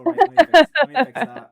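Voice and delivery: high, loud flustered rush; quickly fixing an embarrassing mistake. Real, not acted. Flustered over misspelled name.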